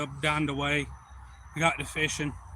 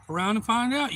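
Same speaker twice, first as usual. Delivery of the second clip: raised-pitch mock warning; imitated tough comeback; retold threat; 7 semitones higher, 4 dB louder than usual. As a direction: dare the other man, retelling it. Retold threat back at the stranger.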